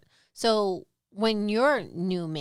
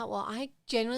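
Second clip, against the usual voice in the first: ordinary talk, nothing marked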